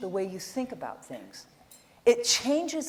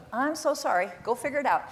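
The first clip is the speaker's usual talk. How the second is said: ordinary talk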